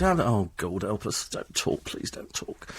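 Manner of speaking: fading into a whisper; pleading whisper. Retold or imitated. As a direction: beg under your breath not to be talked to. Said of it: "You sit in the back of the cab".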